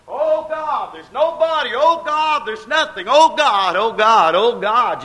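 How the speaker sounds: high, loud wailing plea; weeping cry. Retold or imitated. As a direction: cry out to God with nothing left. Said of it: "you begin to weep and you say".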